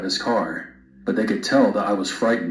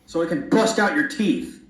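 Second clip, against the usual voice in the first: narrated threat; 8 semitones higher than usual. A narrator's reading. Mother threatens men in car.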